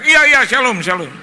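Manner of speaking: high, very loud eager reply easing off; acted over-eager shout, settling at the end. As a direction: keep yelling so the phone signal holds. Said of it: "Pak Pendeta nggak usah teriak".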